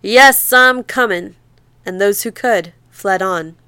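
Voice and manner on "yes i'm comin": loud, higher mock voice of the lake; the flood answering like a beast; growling waters. The pursuing lake shouting back at the fleeing people, hammed-up. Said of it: "growled and shouted ahead".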